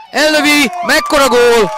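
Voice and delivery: sudden high, loud goal cry; excited hungarian sports commentary. Real, not acted. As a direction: explode on the shot. Live goal call.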